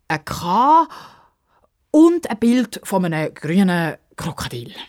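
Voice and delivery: put-on halting, drawn-out storyteller's old man; hesitant old man. A narrator's reading. Old man explaining a special ship, slow and hesitant. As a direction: stretch key words, searching between ums.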